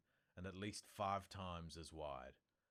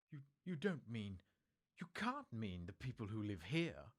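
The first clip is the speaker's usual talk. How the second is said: higher and louder, appalled; crying out; 6 semitones higher, 7 dB louder than usual. A narrator's reading. McGonagall protests to Dumbledore about the Dursleys, crying out. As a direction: protest in disbelief. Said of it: "cried Professor McGonagall".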